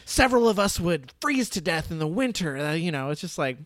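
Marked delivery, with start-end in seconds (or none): 0.0-2.6 hammed-up hard-luck tale, louder on the worst miseries; mock melodramatic self-pity; melodramatic Riddler. Imitating the Riddler on his orphanage winters, melodramatic.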